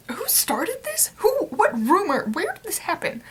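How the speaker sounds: baffled, asking who started it; shocked disbelief. Retold or imitated. Retold shocked reaction to comments.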